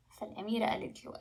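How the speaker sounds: ordinary delivery, nothing marked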